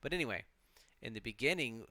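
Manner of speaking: ordinary talk, nothing marked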